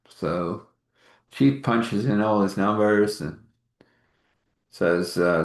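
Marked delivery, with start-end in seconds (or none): none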